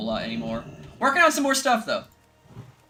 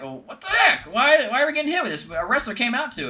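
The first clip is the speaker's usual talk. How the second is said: confused broadcaster; 10 semitones higher, 3 dB louder than usual. Retold or imitated. Broadcaster confused by copyright strike.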